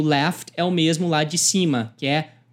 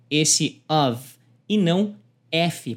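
ordinary talk, nothing marked

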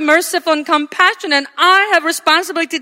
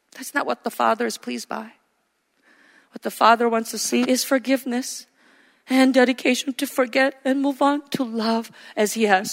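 normal talking voice

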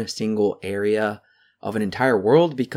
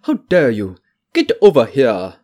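mock of a shaky put-on French-tinged accent, a bit louder; hammed-up bad accent, scolding louder; Jodie Foster's bad accent; 5 dB louder than usual. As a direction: parody her stiff French-style accent as she scolds. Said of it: "I can't take her seriously with that accent".

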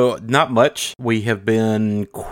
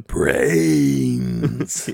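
low drawn-out zombie groan; zombie moan; 4 semitones lower than usual. Pretend. Shirtless co-host interrupts intro, zombie groan.

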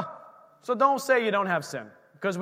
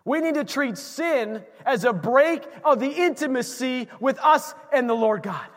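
ordinary delivery

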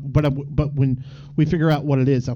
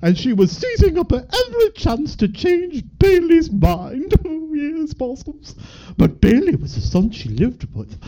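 high, swooping theatrical voice; theatrical reader character; 4 dB louder, slower than usual. Acted. Performing a story passage for the co-host, high theatrical character voice. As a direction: read the story aloud in a high, put-on character voice.

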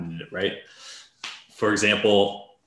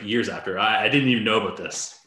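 normal talking voice

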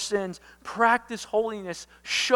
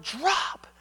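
father quoting his own warning shout; brief raised-voice call; mock yell of a command; re-enacted yell; 8 semitones higher than usual. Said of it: "If I would have yelled".

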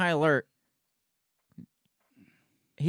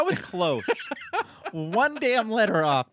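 laughing; 5 semitones higher, slower than usual. Real, not acted. Laughing at own near miss.

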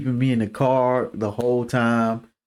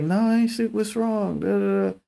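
put-on 1 high girlfriend voice; 8 semitones higher than usual.

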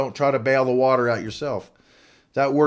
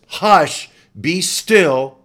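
loud, higher command; commanding hush; 4 semitones higher, 8 dB louder, much slower than usual. Pretend. Jesus speaks to the raging storm, loud commanding call. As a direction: bark it at the storm.

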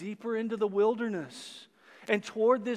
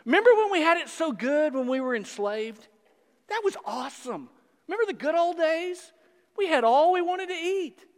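louder, higher sarcastic pining; mock their longing for slavery; preacher mocking the people, higher and louder; 7 semitones higher, 5 dB louder than usual.

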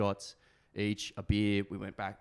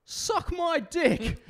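high, slow cockney jeer; hammed-up English accent taunt; fake English accent; 4 dB louder, much slower than usual. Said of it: "put a fake English accent on".

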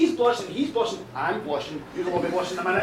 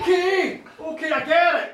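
loud, hasty-sounding cut-in; acted exasperated okay; flustered acknowledgement; 8 dB louder than usual. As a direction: cut off the details loudly. Flustered king cuts her off.

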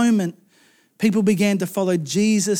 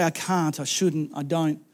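low, soft inner nagging voice; self-doubt; 6 semitones lower, 8 dB quieter than usual. Acted. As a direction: quietly voice the doubts, pitched down.

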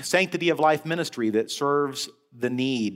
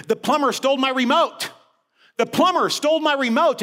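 put-on high, loud, upset old mother's wail; shrill-free high mock panic of an old mom; agitated elderly mother; 12 semitones higher, 5 dB louder than usual. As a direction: play the frantic mother complaining.

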